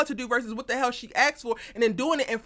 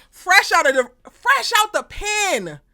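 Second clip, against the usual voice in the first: loud, much higher, every word dragged out; slow scolding; 6 semitones higher, 6 dB louder, slower than usual. Acted. Recapper scolding Sabrina about the felon, slow and loud. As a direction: stretch it out in disbelief.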